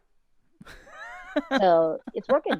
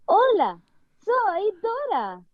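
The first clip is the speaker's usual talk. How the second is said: much higher, drawn-out cartoon girl voice; Dora; 13 semitones higher, slower than usual. Shown as a demonstration. Guest does an iconic impression for the hosts, high cartoon voice. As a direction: pipe it up high like a kids' cartoon heroine. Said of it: "let's do your iconic Dora".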